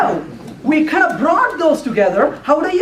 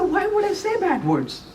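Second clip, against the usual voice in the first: feigned innocence; 6 semitones higher, 6 dB quieter than usual. Retold or imitated. Feigned innocence to his wife's question.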